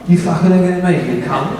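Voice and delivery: rough East End London snarl, fast; East End bloke. Retold or imitated. Actor showing the early biker voice, East End accent. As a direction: spit it like a Cockney biker. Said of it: "sort of an East End bloke".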